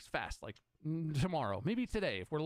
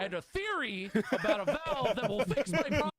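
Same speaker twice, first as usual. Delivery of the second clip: laughing disbelief; 7 semitones higher, 5 dB louder than usual. Real, not acted. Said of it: "livid retelling".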